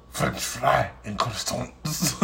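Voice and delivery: slow, soft deadpan French fry and croissants; mock answer. Answering whether she knows French, slow deadpan. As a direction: drag it out like a proud answer. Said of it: "I be like".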